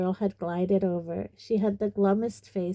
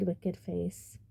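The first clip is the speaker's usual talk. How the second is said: usual speaking voice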